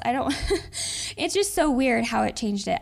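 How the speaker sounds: ordinary talk, nothing marked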